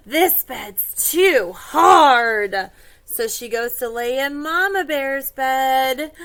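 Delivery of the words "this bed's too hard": slow, loud storybook grumble; dragged-out complaint. Acted. Goldilocks lying in Papa Bear's bed, slow complaint. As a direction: stretch it out loudly in complaint.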